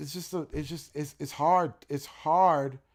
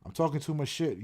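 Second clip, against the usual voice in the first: ordinary delivery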